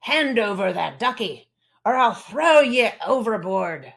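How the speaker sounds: loud gruff pirate captain. Acted. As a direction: growl it like a pirate threatening. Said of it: "You'd talk like a captain with his parrot and sword".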